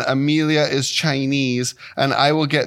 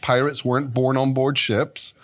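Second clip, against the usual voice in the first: ordinary delivery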